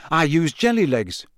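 light, slightly lifted remark. A narrator's reading. George comparing curses with Harry, light tone. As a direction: own up casually. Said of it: "said George lightly".

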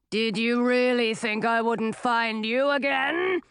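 menacing dragon. A narrator's reading. Dragon threatens wizard.

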